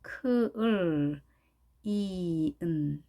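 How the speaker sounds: 크을, 이은 very slow, held L then long EE-n; sound by sound. Shown as a demonstration. Teacher breaking clean apart, slow 크을, 이은. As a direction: draw out 크을, 이은 separately. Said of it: "영어 발음 정확하게 익혀볼까요".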